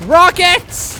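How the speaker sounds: loud, high call of rockets; shout. Real, not acted. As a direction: yell it out to the team. Shouting at teammates in game.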